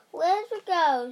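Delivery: mock scream, high and loud; mild scream. A narrator's reading. Witch demanding the girl from her cat, mildly screamed. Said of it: "she screamed".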